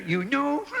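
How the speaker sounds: high elephant voice, nose scrunched up; wrinkled-nose elephant. Acted. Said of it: "wrinkle up my nose to talk like this".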